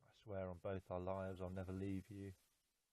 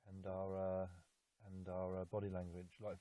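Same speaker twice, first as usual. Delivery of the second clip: normal talking voice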